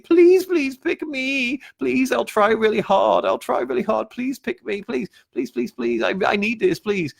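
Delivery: very high, whiny mock pleading; pathetic begging. Coach mimicking a seller desperate to be picked, exaggerated pleading. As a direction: beg desperately in a squeaky, hammed-up voice. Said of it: "pathetic beggar sort of way".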